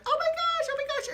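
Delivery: high, loud shrieking voice; shrieking friend. Retold or imitated. Jenny fleeing the grotto, shrieking high. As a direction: squeal it like a scared girl.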